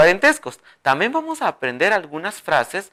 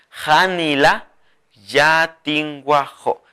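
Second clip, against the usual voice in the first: Janila yatinguajó drawn out; slow dictation; much slower than usual. Shown as a demonstration. Teacher models the phrase slowly for the viewers. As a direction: say it slowly and clearly.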